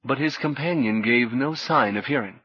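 usual speaking voice

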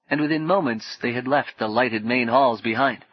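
plain reading voice

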